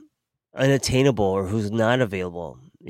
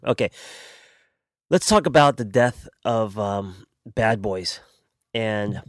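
ordinary talk, nothing marked

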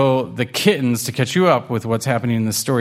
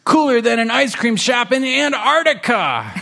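high, excitable kitten voice; high-pitched, hammed-up enthusiasm; interjecting kitten; 12 semitones higher than usual. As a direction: butt in with kid-like hype. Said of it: "Waffles interjects".

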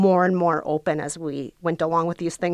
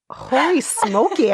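astonished exclamation; 5 semitones higher, slower than usual. Real, not acted. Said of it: "I've never heard [name] that intense".

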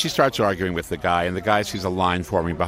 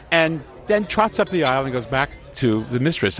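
ordinary delivery

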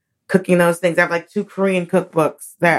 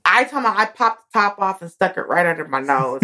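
ordinary talk, nothing marked

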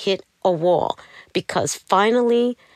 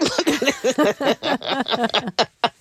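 laugh; 7 semitones higher than usual. Real, not acted. Laughing at the memory.

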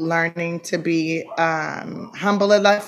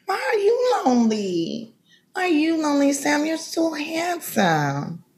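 flirty operator voice; 6 semitones higher than usual. Acted. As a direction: coo it like a phone sex operator.

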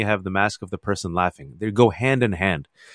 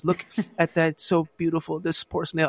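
softer and higher, tenderly moved; tender, near tears; 5 semitones higher, 6 dB quieter than usual. Retold or imitated. Wife cooing at a little snail, softer, higher and tender. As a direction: coo softly over something fragile, almost crying. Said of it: "And she goes".

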